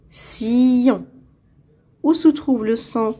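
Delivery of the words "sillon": "Sillon" stretched as siii, llon; syllable by syllable. Shown as a demonstration. Teacher locating a sound for pupils, "Sillon" drawn out by syllables. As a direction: hold the first syllable of "Sillon". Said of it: "Dans la deuxième syllabe".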